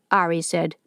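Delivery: plain reading voice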